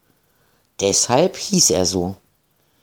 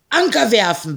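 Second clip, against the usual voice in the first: command; 11 semitones higher than usual. A narrator's reading. Pirate captain orders the anchor dropped, commanding. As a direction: bark the order to the crew. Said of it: "befahl er".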